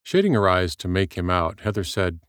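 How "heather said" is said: plain reading voice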